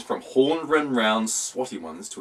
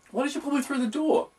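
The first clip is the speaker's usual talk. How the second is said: startled question; faster than usual. Acted. Narrator voices himself startled.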